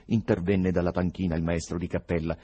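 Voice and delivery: usual speaking voice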